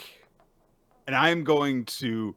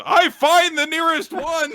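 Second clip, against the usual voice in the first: high loud boast; mock boast; 9 dB louder than usual. Playing the boy boasting loudly. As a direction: shout it proudly. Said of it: "playing the character".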